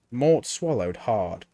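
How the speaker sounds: ordinary delivery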